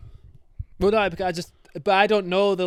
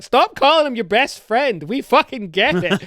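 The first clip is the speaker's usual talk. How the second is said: higher, louder and slow, fed up; exasperated outburst; 5 semitones higher, 7 dB louder, slower than usual. Retold or imitated. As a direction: snap at the game like you've had enough. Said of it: "I'm like".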